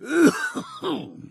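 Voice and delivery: a rough, hacking cough; harsh cough. Acted. Old man's lungs full of fog, hacking cough. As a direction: cough like the fog's in your chest.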